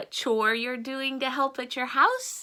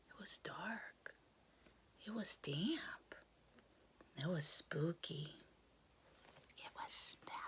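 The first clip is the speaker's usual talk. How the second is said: spooky whisper; 5 semitones lower, 17 dB quieter than usual. A narrator's reading. Storyteller whispering to children about the spooky swamp. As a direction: whisper it like a scary secret.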